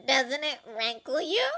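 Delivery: high, slow prying reporter voice; sly journalist fishing for a reaction; Rita Skeeter. A narrator's reading. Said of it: "said Rita, smiling and staring beadily".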